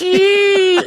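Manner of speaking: high, loud sustained eagle screech; eagle squawk. Retold or imitated. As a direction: imitate a movie eagle's cry, piercing and held. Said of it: "an eagle squawking in the background".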